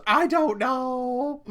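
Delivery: put-on high, loud, drawn-out kid voice; imitated giggly daughter. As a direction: mimic a little kid, stretching the words out. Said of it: "as she's giggling".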